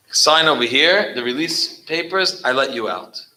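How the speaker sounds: commanding shout. Retold or imitated. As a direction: bark the order to sign. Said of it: "and he says".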